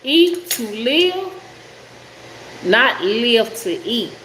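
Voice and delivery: slow, deliberate saying; measured, drawn-out recitation of a motto; saying it right. Shown as a demonstration. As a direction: stretch each phrase out carefully. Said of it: "let's say this right".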